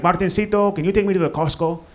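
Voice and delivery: imitated mom with a Bolivian accent. Mom asking son for a ride, Bolivian accent. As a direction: ask sweetly like a Bolivian-accented mother. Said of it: "her Bolivian accent".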